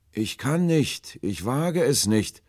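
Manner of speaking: high, loud, drawn-out plea; fearful refusal. A narrator's reading. Stranger dares not approach the ship, pleading high and slow.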